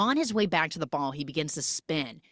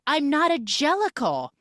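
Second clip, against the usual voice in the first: acted protest; 8 semitones higher, 5 dB louder than usual. Acted reply in retold dialogue.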